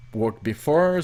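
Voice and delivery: ordinary talk, nothing marked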